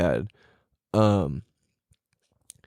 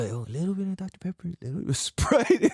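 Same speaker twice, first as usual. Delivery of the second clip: quick, much softer aside; hushed secret; 4 semitones higher, 5 dB quieter, faster than usual. Acted. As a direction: let slip the secret recipe, hushed. Uncle revealing his secret recipe.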